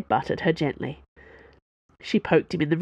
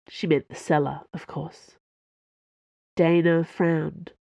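ordinary delivery, nothing marked

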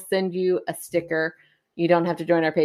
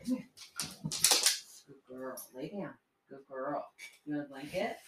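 hushed to dog; 3 semitones lower, 16 dB quieter than usual. Real, not acted. Settling the dog down.

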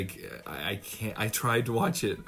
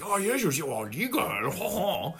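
slow, high, put-on French accent; co-host mocking the alien's French accent; French-sounding Nemoidian; 8 semitones higher, much slower than usual. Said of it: "your Nemoidian's French".

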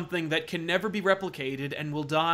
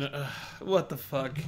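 usual speaking voice

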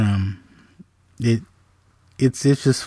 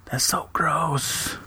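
slow whispered aside, voice raised in pitch; muttered under breath; 5 semitones higher, 7 dB quieter, much slower than usual. Retold or imitated. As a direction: mutter it half-voiced like a grossed-out sister. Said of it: "mumble under her breath".

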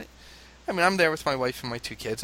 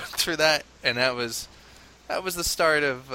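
ordinary delivery

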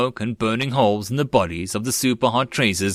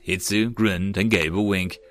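ordinary delivery

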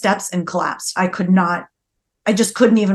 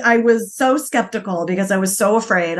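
usual speaking voice

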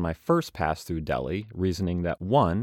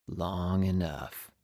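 very slow, softer, trailing off; cool traveler, fading; 5 dB quieter, much slower than usual. Acted. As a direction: let it drift away wistfully. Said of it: "I do need to change voices".

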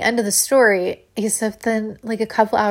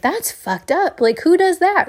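mock outrage; 10 semitones higher, 4 dB louder than usual. Imagined friend's outraged reaction.

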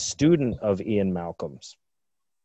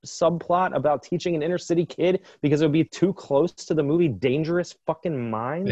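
high, fast, incredulous rant; can't believe this nonsense; exasperated; 7 semitones higher than usual. Real, not acted. Exasperated rant about the writer.

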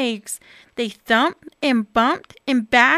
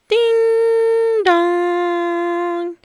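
doorbell chime; 6 semitones higher, 3 dB louder than usual. Acted. Doorbell sound imitated.

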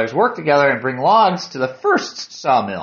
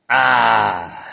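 long, lower "ah" of relief; contented sigh; 4 semitones lower than usual. Acted. Hiker drops the pack and rests, sighing in relief. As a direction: let out a long restful "ah". Sits to rest in the story.

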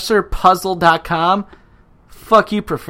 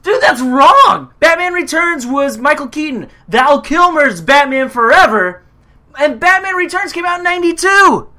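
outraged shout; 11 semitones higher, 9 dB louder than usual. Real, not acted. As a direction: shout the correction in disbelief. Arguing the quiz is wrong.

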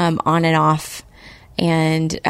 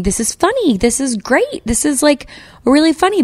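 excited inner voice; 8 semitones higher than usual. Retold or imitated. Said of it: "I kept thinking".

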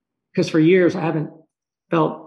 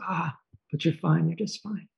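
very soft, soothing quote; gentle reassurance; 8 dB quieter than usual. Retold or imitated. Dream presence answers the dreamer's doubt, gently reassuring. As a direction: reassure softly, fading almost away.

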